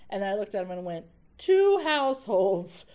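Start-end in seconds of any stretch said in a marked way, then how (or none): 1.4-2.8 slow, loud, grand theatrical delivery; booming Shakespeare prologue, slow and grand; dramatic Shakespeare recitation. Retold or imitated. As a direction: declaim it like a stage actor opening the play. Reciting Romeo and Juliet prologue.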